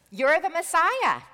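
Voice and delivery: high and loud, sure he's right; eager outburst. Acted. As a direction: blurt it out like the star pupil. Peter eagerly blurts the answer.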